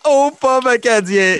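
loud, high, slow show announcer. Acted. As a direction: welcome them like a big show host. Host's big show opening.

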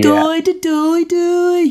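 "Doy, doy" in a high sing-song lilt; Brummie intonation. Shown as a demonstration. Showing the hosts the accent's tune on "doy", high Brummie lilt. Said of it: "the intonation".

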